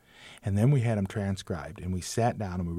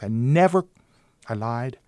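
ordinary talk

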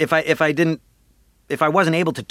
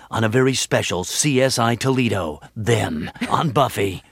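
TV promo announcer; 3 semitones lower than usual. Acted. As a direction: tease the episode like a network announcer. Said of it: "Like in promos".

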